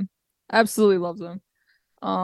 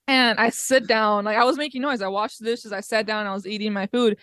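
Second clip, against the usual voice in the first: ordinary delivery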